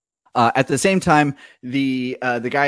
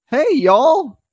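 high, bright folksy hello; high folksy greeting from the top; high cheery stranger; 3 dB louder than usual. Acted. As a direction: call down cheerily from above. GM voices cheerful NPC greeting.

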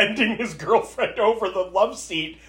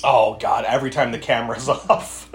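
ordinary delivery

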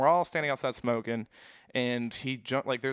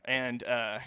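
usual speaking voice

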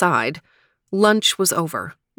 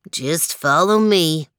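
put-on old dog voice. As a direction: lead them slowly, confident. Lestrade leads.

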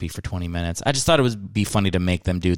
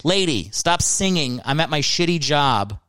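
higher, exasperated mock rant at a customer; fed-up employee; 5 semitones higher than usual. Comedian voices a Geico worker scolding a singing customer, exasperated. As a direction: play the worker who finally snaps. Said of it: "How about you go".

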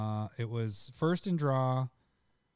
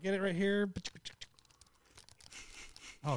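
normal talking voice

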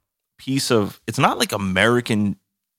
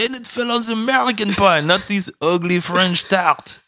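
rich Frenchman; 11 semitones higher, 4 dB louder than usual. Pretend. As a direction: keep up the hammed-up high Frenchman. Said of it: "He's like, some rich guy".

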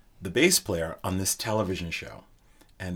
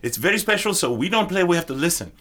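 put-on Israeli accent; 7 semitones higher, 4 dB louder than usual. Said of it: "He has a very heavy Israeli accent".

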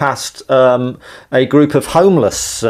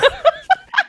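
shrill burst of laughter; high squeal of laughter. Real, not acted. Laughing at own story.

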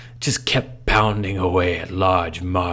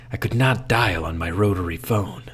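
ordinary delivery

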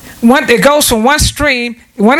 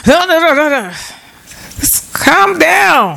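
calming oneself; 5 semitones higher, slower than usual. Retold or imitated. Talking herself down.

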